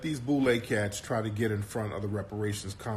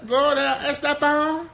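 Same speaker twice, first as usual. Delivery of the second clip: very high, hammed-up Spanish accent; mock Spanish accent; 14 semitones higher, 4 dB louder than usual. Host parodies Latin Music Awards presenter, hammed-up Spanish accent. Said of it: "they talking with a Spanish accent".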